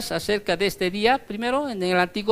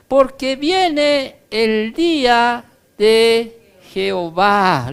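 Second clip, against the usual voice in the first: slow, loud, solemn Bible reading; scripture reading; 4 semitones higher, 4 dB louder than usual. Acted. Preacher reading the warning verse aloud to the church. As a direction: intone the warning slowly.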